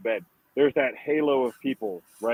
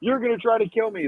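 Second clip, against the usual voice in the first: higher, frantic mock yell at the nurses; imitating a paranoid patient's mild mock yell, pitched higher; 8 semitones higher than usual. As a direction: play the scared homeless man accusing everyone. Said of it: "he's yelling at the, the nurses".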